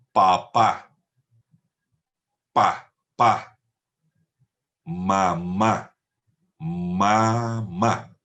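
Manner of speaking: Papá and Pa, pa said crisply with strong puffs on p; syllable demo. Shown as a demonstration. Teacher modelling syllables like for small children. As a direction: say Mamá slowly syllable by syllable. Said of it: "el fonema es la unidad mínima".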